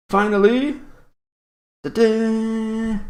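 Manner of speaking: high, loud ta-da; a showman's big reveal; excited reveal. Pretend. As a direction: unveil it with a flourish. Unveiling the quiz picture.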